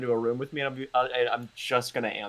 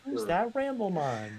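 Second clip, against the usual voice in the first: Pokemon announcer voice; 8 semitones higher, 5 dB quieter than usual. Pretend. Parody of Pokemon catchphrase.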